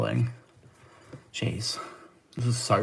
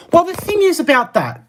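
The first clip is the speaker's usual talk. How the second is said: heated; 12 semitones higher, 5 dB louder than usual. Real, not acted. Launching a heated rebuttal.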